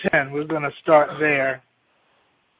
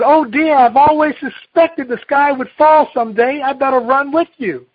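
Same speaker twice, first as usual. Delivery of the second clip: fretful Turkey Lurkey; 10 semitones higher, 6 dB louder than usual. Acted. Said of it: "Turkey Lurkey said".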